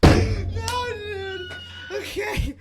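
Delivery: loud laughing protest; excited shout. Real, not acted.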